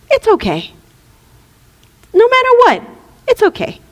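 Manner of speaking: high, airy little helper voice; reassuring helper. Acted. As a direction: soothe in a high put-on voice. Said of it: "I have another helper next to my bouncer".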